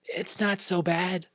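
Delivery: put-on high, slow little-boy voice; small sick child. A narrator's reading. Sick young son answers mother.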